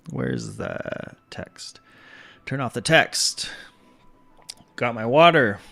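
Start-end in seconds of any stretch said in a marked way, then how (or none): 0.1-1.8 slow, low whispered aside; whispered mutter. Real, not acted. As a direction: mutter it to yourself slowly. Muttered aside while searching.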